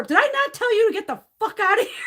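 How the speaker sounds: high, loud, outraged re-enactment; furious retold shout. Telling praying visitors on the stairs to leave, angry shout. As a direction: bellow it like you're chasing them off.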